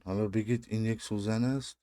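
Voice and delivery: ordinary talk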